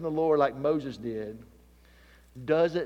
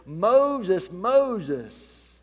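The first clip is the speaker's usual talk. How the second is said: calling out; 4 semitones higher, 7 dB louder than usual. Acted. God calling Moses from bush.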